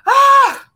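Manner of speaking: loud, very high open ah; big high exhale of release; expansive sigh. Shown as a demonstration. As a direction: let the chest burst open. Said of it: "I can feel it in my chest".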